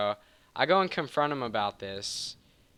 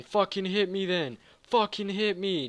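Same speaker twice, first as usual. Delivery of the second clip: higher, mock-aggressive challenge; retold angry taunt; 8 semitones higher than usual. Roommate daring him to throw a punch, retold angry taunt. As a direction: bark the dare at him.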